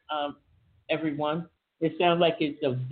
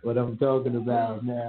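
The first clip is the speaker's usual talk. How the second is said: lowest bass register, playful; deep low voice; 5 semitones lower than usual. Pretend. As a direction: push the voice down deep. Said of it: "how low I can go".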